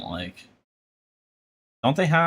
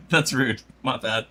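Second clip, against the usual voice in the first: ordinary talk, nothing marked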